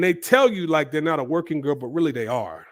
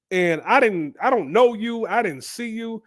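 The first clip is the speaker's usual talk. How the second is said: retold inner protest; 6 semitones higher than usual. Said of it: "that's what's running through his mind".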